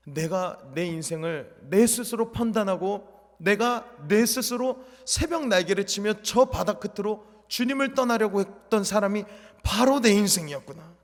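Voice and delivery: weeping in the shower, realizing God never left; sobbing confession. Retold or imitated. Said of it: "샤워기를 들고 엉엉 울었습니다".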